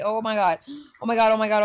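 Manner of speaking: normal talking voice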